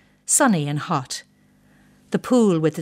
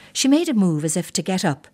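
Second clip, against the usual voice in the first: plain reading voice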